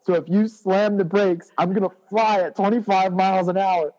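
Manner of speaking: high, mock-earnest voice explaining physics; imitating a friend. Retelling to the friend what he said in the van, high mock-earnest voice. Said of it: "you said, you said".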